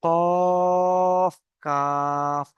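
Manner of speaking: very slow recitation of letter names; drawn-out letter recitation. Shown as a demonstration. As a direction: stretch each letter name long and sung. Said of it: "তাহলে কি হবে?".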